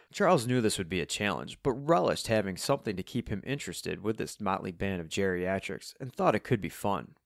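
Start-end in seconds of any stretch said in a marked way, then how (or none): none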